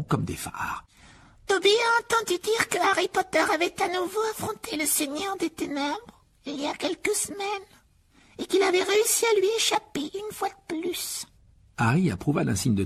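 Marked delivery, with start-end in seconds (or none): 1.5-11.3 house elf Dobby. Acted. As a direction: squeak it out like an earnest little elf.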